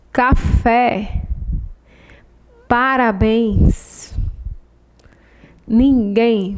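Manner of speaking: "Café", "Parabéns", "Ninguém" each with a strong final syllable; isolated words, "fé", "béns" and "guém" punched; last-syllable stress. Shown as a demonstration. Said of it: "A sílaba mais forte é a última".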